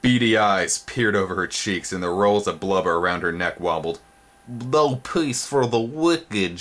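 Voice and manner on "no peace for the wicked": high, slow monstrous matron mutter; slow, high creature voice muttering to herself; gross mother voice. Acted. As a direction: grumble like a huge old housekeeper. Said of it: "she muttered".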